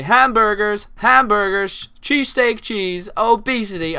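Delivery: high, loud hammed-up chant of food words. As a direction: shout it as a caricature of Americans. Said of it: "I don't see Americans say".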